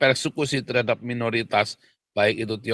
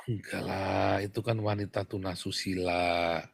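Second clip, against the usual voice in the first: low, soft mock-polite correction; hushed, mock-delicate objection; mock euphemism; 6 semitones lower, 7 dB quieter than usual. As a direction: imitate someone gently smoothing it over.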